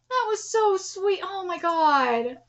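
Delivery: slow, soft, drawn-out words; touched. Real, not acted. Reader moved by the sweet dream, slow and soft. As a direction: stretch it out softly, overcome.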